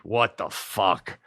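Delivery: normal talking voice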